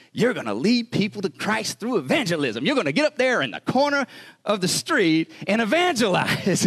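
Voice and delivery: high, rousing pep-talk voice; excited missionary. Retold or imitated. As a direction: imitate a missionary hyping up his recruit. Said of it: "he got you all excited when he talked to you".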